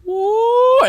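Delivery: loud squeaky falsetto in awe; mock falsetto amazement; high-pitched whoa. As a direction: gasp at the sight in a tiny voice. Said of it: "and he's like".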